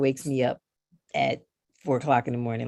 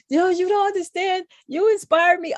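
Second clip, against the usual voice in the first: much higher put-on voice of a gushing admirer; imitating an emotional fan; 3 dB louder than usual. A crying fan telling Gigi she inspires her, high and gushing. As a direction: play the teary fan blurting it out. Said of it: "they'll start crying".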